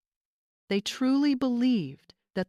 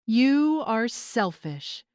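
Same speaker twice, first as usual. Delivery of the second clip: slow, louder, bitter accusation; hissed; 4 semitones higher, 5 dB louder, much slower than usual. A narrator's reading. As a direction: spit each word at her mother. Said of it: "Angela hissed".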